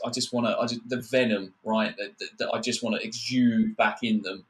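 ordinary delivery

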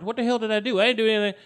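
high, indignant innocence; alarmed protest. Acted. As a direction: protest as if wrongly accused. Acted protest at hearing warrant.

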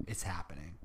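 whispered, conspiratorial; mock whisper. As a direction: breathe it like urgent secret news. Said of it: "She's like".

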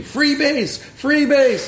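high-pitched imitation of a yelling dog owner; mock scream. Storyteller imitating woman chasing her dog, high mock scream. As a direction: imitate someone shrieking after a runaway dog, half-voiced.

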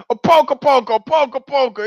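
frantic fake bayonet jabs; Italian soldier. Soldier jabs fake bayonet in mock Italian accent. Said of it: "He's like".

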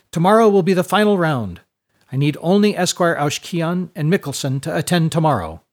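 high, loud classroom announcement; excited rector. A narrator's reading. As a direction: proclaim it to the whole class. Rector announces final round to class.

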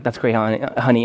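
mom's bored reply. Retold or imitated. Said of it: "She's like".

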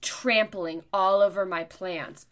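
loud indignant accusation; mock outraged scolding; retold yelling. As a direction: imitate an angry stranger's loud complaint. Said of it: "I can't believe that she's yelling at me".

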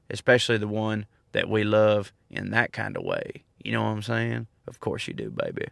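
mock-sultry sign-off, voice dropping away; playful seductive murmur. Playful romantic sign-off to listeners.

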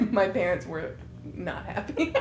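ordinary delivery

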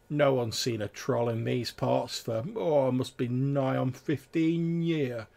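country grumbler pooh-poohing a tall tale; dismissive old companion. Acted. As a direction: drawl it out as a doubting local.

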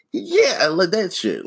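very high, put-on fake-smile voice; fake super happy. Shown as a demonstration. Worker showing the fake cheer managers want, fake super happy. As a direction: squeal it through a huge fake smile. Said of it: "super fake smile".

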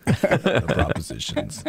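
drowsy and hushed, as on early morning radio; sleepy radio voice. Real, not acted. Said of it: "It's like early morning radio".

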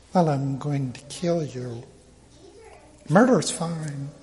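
soft, offhand aside; mock-casual hypocrite. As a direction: toss it off softly as if it were nothing. Mocks hypocritical Saul's casual murder.

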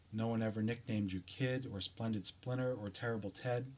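usual speaking voice

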